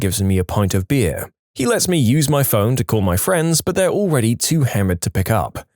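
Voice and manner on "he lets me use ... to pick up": ordinary delivery, nothing marked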